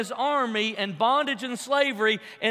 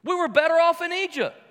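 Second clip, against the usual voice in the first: higher-pitched imitated complaint; mock outcry from the people; crying out; 6 semitones higher, 4 dB louder than usual. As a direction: protest like the frustrated crowd, preacher-style. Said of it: "They started to cry out".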